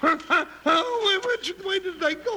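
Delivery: jolted awake and flustered; sputter, just woken and confused; high startled blurt. Acted.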